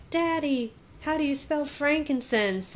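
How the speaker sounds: high-pitched imitation of a kid; child's voice. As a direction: pipe up like a kid asking Dad. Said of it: "his kids are like".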